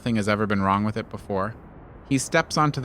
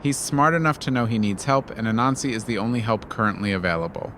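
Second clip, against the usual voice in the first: usual speaking voice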